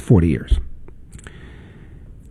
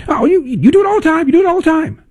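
high, whiny mock of a flustered caller; faster than usual. Host mocking a caller who can't answer, high whiny voice. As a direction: squeak it out like a caught-out accuser.

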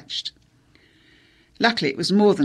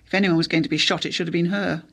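plain reading voice

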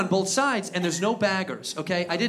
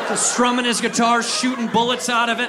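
loud, raised patriotic rant; worked-up, higher and louder narration; fired-up shouting; 6 semitones higher, 4 dB louder than usual. Acted. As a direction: yell it as a triumphant fantasy. Shouted patriotic revenge fantasy.

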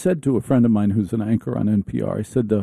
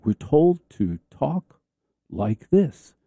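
slow, pausing before the last words; exaggeratedly slow; 3 semitones higher, much slower than usual. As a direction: spell it out slowly as if for a dim listener. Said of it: "explained to them slowly".

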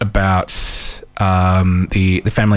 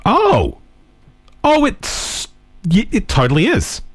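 dawning realisation; 11 semitones higher than usual. Retold or imitated. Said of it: "I'm like".

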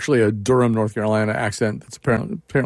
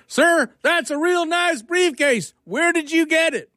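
very high, slow mock yell in a Georgia accent; slower than usual. Puzzled Georgia guy repeats himself to the professor, high slow Georgia holler. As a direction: call it out slowly and high-pitched, Georgia-style. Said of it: "then he shrugs and says".

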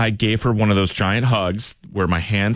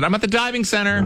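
mock prank excuse; 9 semitones higher than usual.

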